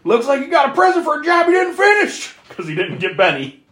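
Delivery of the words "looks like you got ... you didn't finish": high, loud angry yell at him; pissed-off shout. Retold or imitated. Tinker yelling at Leatherface over the unfinished job, angry shout. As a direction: bark it like a furious boss. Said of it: "comes in all pissed off saying".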